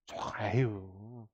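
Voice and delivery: a hint of a reluctant mother begging; pleading family. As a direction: coax the servant to linger a few days. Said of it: "이렇게 얘기합니다".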